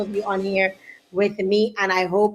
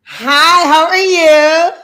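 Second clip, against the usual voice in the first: high, loud, stretched-out hello; excited greeting; 5 semitones higher, 9 dB louder, much slower than usual. Real, not acted. Excited greeting of arriving guest.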